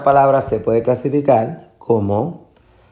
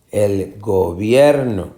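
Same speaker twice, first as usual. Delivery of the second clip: gobierno said slowly, BIER lengthened and raised; stress on middle syllable; slower than usual. Shown as a demonstration. Teacher repeats for students, stressing BIER in gobierno. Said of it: "Esta es la sílaba tónica".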